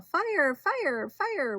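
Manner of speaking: high-pitched alarm cry; mild storybook yell. A narrator's reading. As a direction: call out a fire warning, voice well up. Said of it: "was just about to yell".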